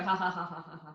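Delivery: soft, shallow demo laugh fading out; light throat laugh. Shown as a demonstration. Teacher shows where most people laugh, light throat laugh. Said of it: "Just very lightly".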